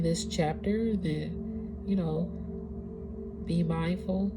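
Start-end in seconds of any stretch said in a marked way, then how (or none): none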